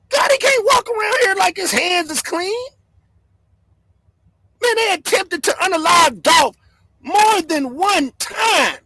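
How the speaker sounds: worked-up vlogger ranting to his chat; heated rant. Real, not acted. Heated rant about Dolph.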